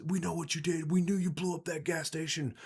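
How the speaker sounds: high, shouted interrogation, pitch climbing; bounty hunter roaring accusations at the pinned-down boss; screaming accusations. Retold or imitated. Bounty hunter yelling at Tim, high shouted accusations. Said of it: "screaming in his face".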